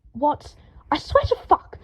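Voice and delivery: high exasperated shout. A narrator's reading. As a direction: shout in frustration. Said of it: "Draco shouted".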